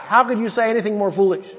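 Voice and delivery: normal talking voice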